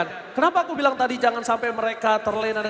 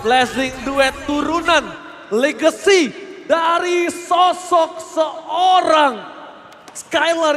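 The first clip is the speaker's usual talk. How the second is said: drawn-out hype yell; hype shout; 4 semitones higher, 4 dB louder, much slower than usual. Real, not acted. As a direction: stretch the words out, shouting. Caster hypes a big play. (teriakan heboh)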